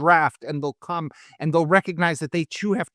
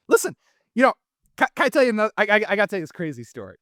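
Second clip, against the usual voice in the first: worked up; 5 semitones higher, 4 dB louder, much faster than usual. Real, not acted. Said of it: "You can see I'm a little worked up".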